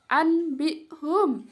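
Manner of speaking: ANBIHUM with a plain n before b; as written. Shown as a demonstration. Teacher reading the written word, clear n. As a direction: spell out ANBIHUM slowly, n unchanged.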